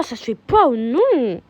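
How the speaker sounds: ordinary talk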